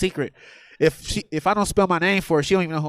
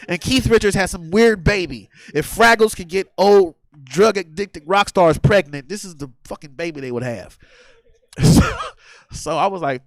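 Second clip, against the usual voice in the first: normal talking voice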